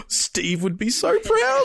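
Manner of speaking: high, slow, awestruck; awed gush. Retold or imitated. Gushing at the TV with pride, awed high voice. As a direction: swoon with pride, drawn out.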